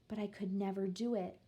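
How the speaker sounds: usual speaking voice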